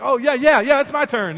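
high, loud eager yes; cowed boy. Retold or imitated. Boy covering for sister's blackmail, high loud eager agreement.

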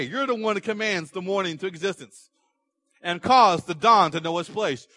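ordinary talk, nothing marked

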